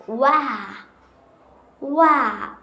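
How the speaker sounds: where said wair with no r; British where with no final r; British accent. Shown as a demonstration. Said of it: "like in W E R E".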